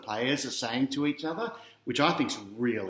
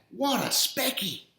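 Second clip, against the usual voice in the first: put-on much higher, awestruck boy voice; amazed boy; 10 semitones higher than usual. A narrator's reading. The boy watching a spectacular mark, high and amazed. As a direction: gasp it out like a wide-eyed kid. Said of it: "says the boy".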